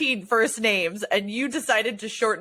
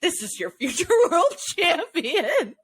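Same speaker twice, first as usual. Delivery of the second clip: laughing mock quote; 9 semitones higher than usual. Mocking a hyped-up quote.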